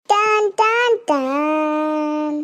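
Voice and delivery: slow drawn-out tan tan tan; dramatic sting. Acted. Boy imitating the Bigg Boss suspense sound, slow.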